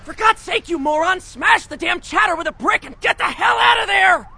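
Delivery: frantic high-pitched yelling; furious, urgent outburst at top of the range; exasperated shout. Acted. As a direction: scream orders at someone being dense. Exasperated order to flee.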